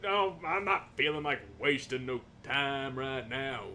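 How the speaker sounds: normal talking voice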